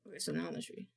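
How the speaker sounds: low unvoiced whisper. Real, not acted. Streamer whispers while testing audio, hushed. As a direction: breathe it out in a hush.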